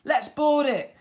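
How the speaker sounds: high, loud eager cry; rally the crew to board; captain Flynn urging the attack; cried out. A narrator's reading. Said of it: "cried Captain Flynn".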